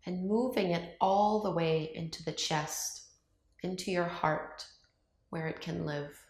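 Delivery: calm meditation voice with long, unhurried pauses; guided meditation. Acted. Yoga teacher guiding viewers to open up to love, calm meditation voice. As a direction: ease them through each breath, leaving silence between phrases.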